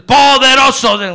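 loud, high burst that chokes off; mock singer bursting out, then strangled; throat briefly released. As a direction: blurt it out before the grip returns. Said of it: "le afloja un poquito aquí".

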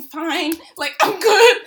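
high, loud insistence on being fine; stressed cover-up. Retold or imitated. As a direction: insist too brightly, pitch and volume climbing. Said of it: "I'm stressed".

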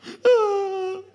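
mimicked sobbing gasp. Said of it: "The mom starts crying".

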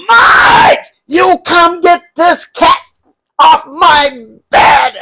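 high, loud shriek of a disgusted mom; mom screaming. Retold or imitated. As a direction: shriek it like a neat-freak mom who just found a mess. Mom screaming at cat mess.

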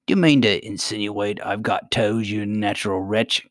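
plain reading voice

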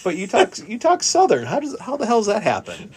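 pitched high, baffled and mock-outraged; exasperated disbelief. Telling relatives off about their southern talk, high and exasperated. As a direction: throw up your hands: how does that happen.

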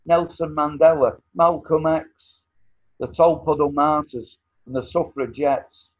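rally the crowd with each name; fiery revolutionary speech. Acted. Roll call of heroes.

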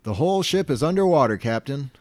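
high, happy and chipper; cheerful report. Retold or imitated. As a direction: report disaster with a smile. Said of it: "he says it kind of, like, happy".

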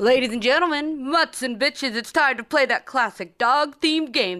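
booming game show host intro; announcer voice. Pretend. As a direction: hype up the crowd like an announcer. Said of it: "I like your announcer voice".

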